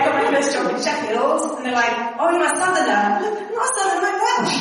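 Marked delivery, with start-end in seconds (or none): 2.2-3.1 mock surprise. Sheffield colleagues' retold remark. 3.2-4.6 indignant protest. Retold or imitated. Retold indignant correction.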